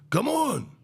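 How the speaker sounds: impatient urging. Retold or imitated. Friend urging stubborn donkey across the bridge, high and impatient. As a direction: hurry the stubborn animal along. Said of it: "this guy goes".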